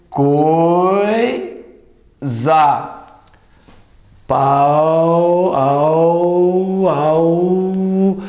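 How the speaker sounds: "pau" and "au" held long, a and u glided together; drawn-out diphthong. Shown as a demonstration. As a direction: stretch "au" as one gliding breath. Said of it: "sai o A e sai o U na mesma emissão".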